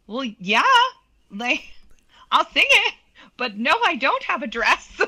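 high, laughing eager yes. Retold or imitated. Agreeing to sing the show, laughing that she has no dress. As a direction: say yes while laughing at the problem.

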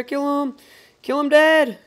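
loud, rising battle cry; excited yell. Real, not acted. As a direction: yell for the kill. Excited kill call in game.

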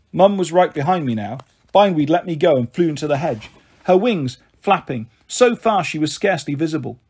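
ordinary delivery

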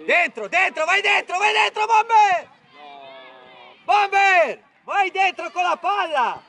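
rising, insistent shouts to attack; mounting touchline urgency; urgent shouting. Real, not acted. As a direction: yell the player forward, building with each call. Coach urging player forward.

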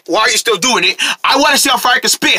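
normal talking voice

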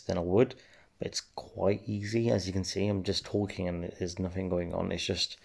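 ordinary talk

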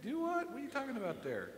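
high, quick puzzled question; a touch of mock disbelief; mock bafflement. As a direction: act baffled at the verse. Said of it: "mock baffled reaction".

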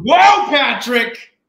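a loud, high, drawn-out whoa; surprised shout. Real, not acted. Guest startled the host knows his old school, loud slow whoa. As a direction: cry out, caught off guard by the host's research.

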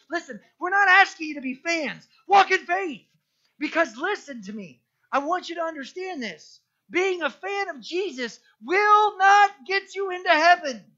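high, loud exhortation; full-force preaching. Acted. Preacher exhorts the congregation not to be fans, loud and high. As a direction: urge them hard to walk in faith.

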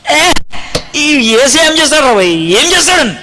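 high, slow, scornful angry voice; angry retort mocking Jesus; angry challenge. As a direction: throw the challenge up in anger. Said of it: "మస్తు కోపం వచ్చింది".

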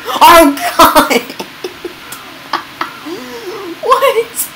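laughing, baffled at the game's chaos. Real, not acted. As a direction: laugh through the question in disbelief. Chaos in game.

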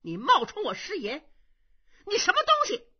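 rising to a loud high accusation; angry challenge. Acted. Young monk confronting the impostor, angry. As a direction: glare and demand who he is. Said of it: "冲孔秀一瞪眼".

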